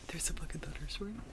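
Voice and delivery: gasp, then a soft whispered question; awed whisper. Real, not acted. Hearing a thunderstorm came while writing, awed whisper. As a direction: breathe it out in hushed disbelief. Gasps at eerie coincidence.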